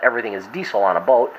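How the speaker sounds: ordinary delivery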